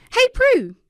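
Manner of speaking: ordinary delivery, nothing marked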